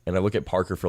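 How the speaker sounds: usual speaking voice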